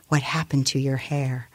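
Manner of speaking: ordinary delivery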